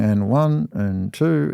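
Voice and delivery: slow, deliberate count; counting slowly. Retold or imitated. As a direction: count it out in perfect rhythm. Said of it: "I'm going".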